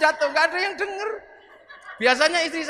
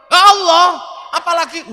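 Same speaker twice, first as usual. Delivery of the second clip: loud and higher, fed up; exasperated shout; 5 semitones higher, 10 dB louder than usual. Retold or imitated. Umar yells impatiently at the questioning angels. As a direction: throw the words out in irritation.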